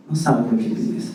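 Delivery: wife's soft low warning; hushed, knowing warning from the wife; quiet warning. Retold or imitated. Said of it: "dice mi esposa".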